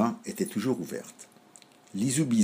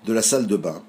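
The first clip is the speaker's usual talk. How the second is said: plain reading voice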